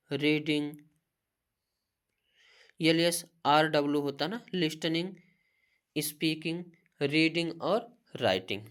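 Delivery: ordinary talk, nothing marked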